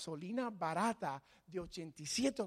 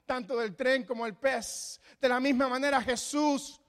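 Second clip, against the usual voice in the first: louder, higher climactic preaching; preaching at full force; 6 semitones higher, 6 dB louder than usual. Acted. The pastor urges the church to believe, full fervour. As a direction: drive the point home with fervour.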